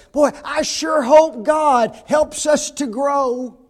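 loud, slow, high mock hoping; drawn-out, hammed-up wishing; mimicked wishful comment. Preacher mimics church members hoping for growth, mock wishful. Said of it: "we make comments like this".

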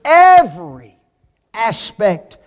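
EVERY punched and set apart before "aspect"; deliberate pause after a stressed "every". Real, not acted. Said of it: "and I emphasize that word".

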